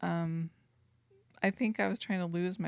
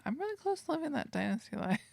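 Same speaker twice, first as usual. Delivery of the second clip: higher, fast dreamy self-quote; dreamy wistful; 8 semitones higher, faster than usual. Retold or imitated. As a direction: gush it like a daydream. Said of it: "my whole life has been spent thinking".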